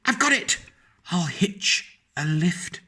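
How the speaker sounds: high, eager eureka cry, then slowing; excited cry. Acted. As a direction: burst out with the bright idea. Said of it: "then cried".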